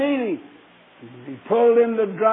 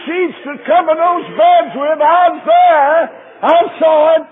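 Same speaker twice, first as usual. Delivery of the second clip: whooping preacher climax; preaching at full force; 5 semitones higher, 5 dB louder than usual. Acted. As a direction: climb higher with each I was there. Preaching builds to shouting climax.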